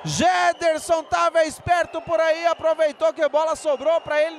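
goal call. Real, not acted. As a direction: erupt into a goal shout.

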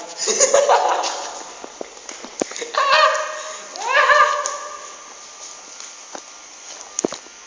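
shrill breathy laugh. Real, not acted. Scared burst in horror game.

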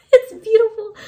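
overwhelmed, crying over a toy; real tearful cry. Real, not acted. Vlogger tearing up over her grail Funko Pop, crying. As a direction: voice breaking high into tears. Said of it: "I literally wanna cry".

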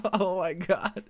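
breathy laugh into a giggly oh my god. Real, not acted. Gamer thrilled at twelve spawners, laughing. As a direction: let the delight spill into a laugh.

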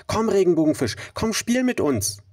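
high, bright calling out; high calling fish voices. Acted. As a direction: call out playfully in a high voice. Other fish call out.

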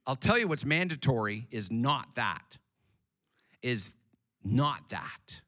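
usual speaking voice

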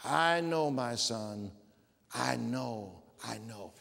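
put-on low, soft voice of an old father; old Israel speaking gently. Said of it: "you hear these words from Israel".

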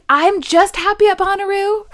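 mock a starry-eyed festivalgoer; gushing festival fan. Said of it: "I hear this a lot with Bonnaroo".

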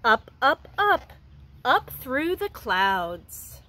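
gentle storybook reading for a class; reading to children. Acted. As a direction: read it like a picture book to young kids. Teacher reads picture book aloud.

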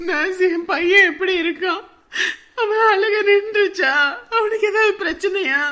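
crying plea. Acted. Anxious mother asks nurse.

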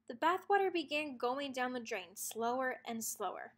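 ordinary delivery

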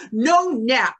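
put-on commanding toddler. Grandson refusing his nap, loud and bossy. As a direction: bark it like a tiny boss. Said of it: "he commands".

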